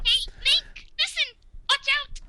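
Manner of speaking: very high fairy squeak; Navi squeaking. Retold or imitated. Streamer squeaking Navi's warning to Link. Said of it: "me do more Navi".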